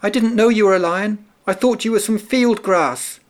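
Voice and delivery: high mouse voice, rushing the first line fast; tiny mouse explaining in a rush; hurried little mouse. A narrator's reading. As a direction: gabble up high like a nervous mouse. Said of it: "the mouse said quickly".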